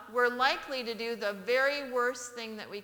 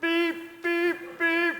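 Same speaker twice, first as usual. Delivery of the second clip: higher, louder imitated horn; louder horn beeps; 6 semitones higher, 5 dB louder, much slower than usual. Father honking for mother, louder mimicked horn. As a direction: honk it out louder each time.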